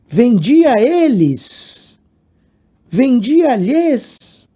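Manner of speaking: "vendia eles, vendia lhes" dragged out slowly; slowly parading wrong forms; mocking the wrong options. Shown as a demonstration. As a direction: read the exam's wrong answers very slowly. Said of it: "tudo errado".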